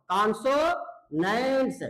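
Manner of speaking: कॉन्सोनेंट्स drawn out slowly, syllable by syllable; slow teaching word. Shown as a demonstration. Teacher to students, stretching कॉन्सोनेंट्स slowly. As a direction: spell out कॉन्सोनेंट्स slowly for the class. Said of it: "कॉन्सोनेंट sound से start होता हो".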